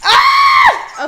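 loud piercing scream; high shriek. Real, not acted. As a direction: let out a frustrated shriek. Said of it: "I'm sorry for all that yelling".

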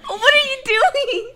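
loud, slow laughing what are you doing; cracking up while asking; laughing question. Real, not acted. Laughing at friend's antics.

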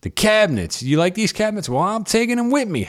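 high mock seller voice; imitating the seller. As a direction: pitch it way up as the smug seller. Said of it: "he did this through the whole house".

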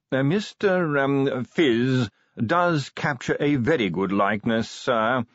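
conceding butler. A narrator's reading. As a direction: concede it delicately, with formal care. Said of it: "the butler conceded".

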